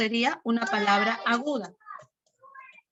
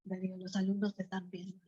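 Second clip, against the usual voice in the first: hushed aside; 6 semitones lower, 11 dB quieter, faster than usual. Real, not acted. Teacher tells Darío the students are watching, hushed aside. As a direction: keep it quiet and low, away from the class.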